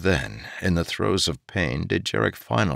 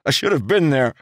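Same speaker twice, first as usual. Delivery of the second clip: guilty outburst; 9 semitones higher, 5 dB louder, faster than usual. A narrator's reading.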